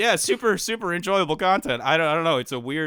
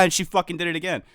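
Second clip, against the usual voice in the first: ordinary talk, nothing marked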